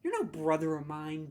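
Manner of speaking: normal talking voice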